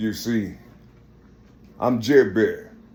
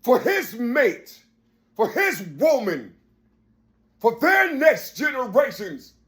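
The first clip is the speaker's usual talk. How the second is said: fiery sermon cadence rising to shouts; preaching; 14 semitones higher than usual. Acted. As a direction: preach it hard to the crowd. Preaching at full force.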